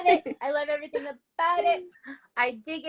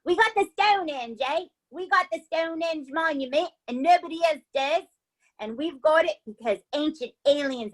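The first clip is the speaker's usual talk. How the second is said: put-on horrible Cockney accent. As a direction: ham up a bad Cockney accent, mixing in bits of Southern and Australian. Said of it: "like, a horrible Cockney accent".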